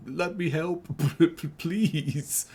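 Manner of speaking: high-pitched, hammed-up whine; mock-beg in a high whine, laughing midway; whining plea. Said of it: "basically just whining and being like".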